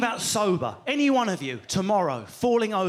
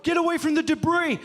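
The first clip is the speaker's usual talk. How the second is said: higher, louder urgent command; bouncer's bark; 8 semitones higher, 4 dB louder than usual. Retold or imitated. Bouncer yelling at comic to leave the stage, urgent shout. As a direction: order him off the stage fast.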